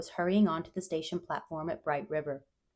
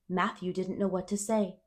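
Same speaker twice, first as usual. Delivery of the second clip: ordinary delivery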